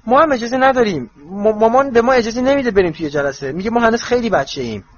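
high, loud, stammering protest; urgent interruption. A narrator's reading. Ron interrupting Harry about being barred from meetings, urgently protesting. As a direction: cut in hurriedly, defending yourself. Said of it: "رون میان حرفش پرید".

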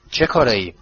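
mildly nonchalant question; cool, unruffled. A narrator's reading. Boy answering his uncle's angry question, coolly. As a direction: play it cool, unbothered. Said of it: "هری با خونسردی گفت".

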